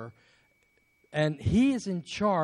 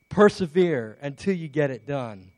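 ordinary talk, nothing marked

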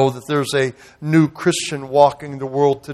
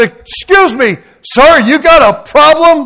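confrontational challenge; 11 semitones higher, 7 dB louder, slower than usual. Retold or imitated. Confronting the young man.

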